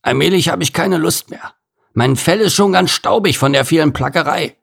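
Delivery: sulky gorilla complaining; grumbling gorilla. Acted. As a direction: moan like a fed-up big ape. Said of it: "maulte er".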